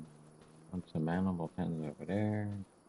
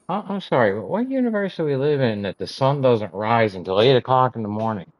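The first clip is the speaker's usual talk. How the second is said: loud, higher sarcastic complaint; outraged rant; 5 semitones higher, 15 dB louder than usual. Real, not acted. Gamer shouting at the game about eight o'clock sunrise. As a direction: yell the question in disbelief.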